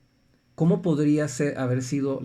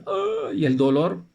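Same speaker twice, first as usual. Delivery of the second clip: slow pained groan, voiced higher and louder; reliving pain; 6 semitones higher, 4 dB louder, slower than usual. Father retelling twisted ankle to audience, slow pained groan. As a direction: let the pain come through the ah.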